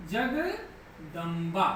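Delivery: Jagdamba drawn out slowly, syllable by syllable; slow dictation. Shown as a demonstration. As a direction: spell Jagdamba out very slowly for the class. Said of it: "आप लोग देखिएगा".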